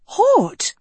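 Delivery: high, startled gasp of a name. A narrator's reading. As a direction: gasp it in shock. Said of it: "Nicola gasped".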